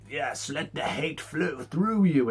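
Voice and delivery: lightly voiced evil Emperor, drawn out; Palpatine heckling. Palpatine heckling Luke to give in, slow and sinister. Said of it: "saying stuff like".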